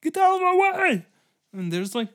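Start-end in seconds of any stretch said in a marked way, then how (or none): 0.0-1.0 very high, loud, fast shout; elephant riders. Retold or imitated. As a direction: bark it while charging through. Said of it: "they're like".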